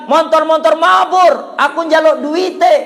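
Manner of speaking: high, loud, stretched-out yell; children shouting. Retold or imitated. Village kids running after a plane, high drawn-out shouting. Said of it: "orang kampung teriak-teriak".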